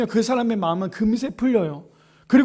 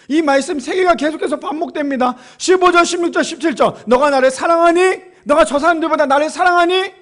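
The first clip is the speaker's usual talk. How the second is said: loud, high pulpit voice; fervent preaching; 6 semitones higher, 6 dB louder than usual. Acted. Pastor voices Jesus questioning Peter, fervent preaching. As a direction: press the repeated question home.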